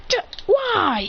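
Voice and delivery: high, stretched "why"; whiny protest. Acted. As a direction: protest like a refused kid. Kids protest at being refused.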